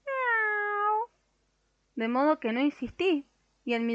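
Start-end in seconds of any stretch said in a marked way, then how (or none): 0.0-1.1 high meow rising at the end; a cat picking up the vet's phone, cross meow; grumpy cat meow. A narrator's reading. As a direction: mew it like an annoyed cat woken at night. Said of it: "otra voz igualmente malhumorada".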